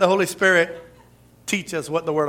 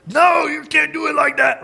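sharp high shout of an imagined angry text; mock-angry shout reading a text; 11 semitones higher, 6 dB louder than usual. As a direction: bark it as if the text were furious. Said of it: "because I'm angry, I'm gonna hear it".

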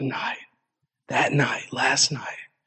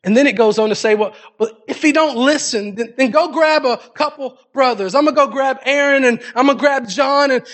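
preaching at full force; 8 semitones higher than usual. Acted. Preacher retelling Matthew eighteen to the congregation, full-force preaching.

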